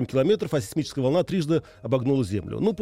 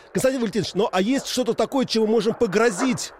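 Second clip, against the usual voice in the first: to someone hard of hearing; 8 semitones higher, 4 dB louder than usual. Real, not acted. Caller on a noisy line.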